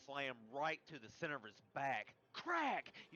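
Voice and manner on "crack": loud, high imitated crack; bone crack. A narrator's reading. GM describing the shovel blow, a loud mimicked crack. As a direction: smack out the bone-breaking sound. GM narrates shovel hitting back.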